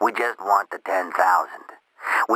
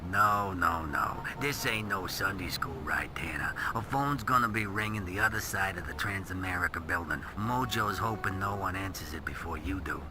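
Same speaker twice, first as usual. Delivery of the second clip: much softer, menacing whispered taunt; hushed threat; 11 dB quieter than usual. Acted.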